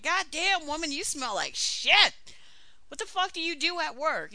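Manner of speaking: high, loud cop's disgust; appalled outburst. Acted. Cop at the car window smelling the woman, acted appalled outburst.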